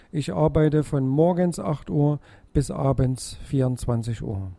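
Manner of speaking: usual speaking voice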